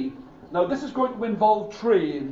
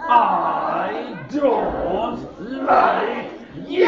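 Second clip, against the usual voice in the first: grumpy shout; 9 semitones higher, 5 dB louder, much slower than usual. Acted. Grumpy figure shouts at boy.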